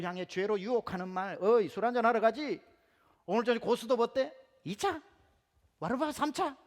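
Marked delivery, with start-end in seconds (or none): 1.4-6.6 higher, louder, a buddy urging one more round; loud jolly drinking invite. Retold or imitated. Preacher voicing a buddy luring a friend into drinks, jolly and loud.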